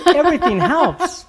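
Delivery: laughing high through the words. Real, not acted. Host laughing as he repeats his answer. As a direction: laugh it out, amused.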